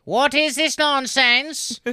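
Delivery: high, slow scolding mother; scolding mom. Retold or imitated. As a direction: demand it like a fed-up mom. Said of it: "Mama told me".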